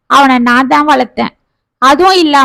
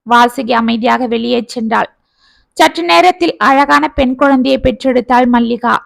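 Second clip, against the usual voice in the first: usual speaking voice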